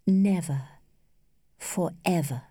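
each word set apart, musingly; musing repetition. A narrator's reading. Lady repeats the words to herself, musing. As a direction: dwell a moment on each word, pausing between. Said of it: "mused for a moment over the words".

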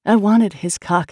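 plain reading voice

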